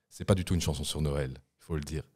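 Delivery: ordinary talk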